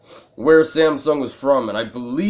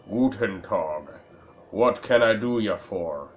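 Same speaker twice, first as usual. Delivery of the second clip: put-on gruff German accent; 4 semitones lower, 4 dB quieter than usual. Old junk dealer greets a customer, light gruff German accent. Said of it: "speaks with a gruff but well-meaning tone, heavily accented with a German".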